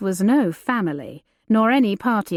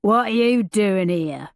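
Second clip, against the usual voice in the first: lightly voiced gruff bearded brute; a hint of a rough menacing giant; gravelly demand. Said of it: "gravelly voice he demanded".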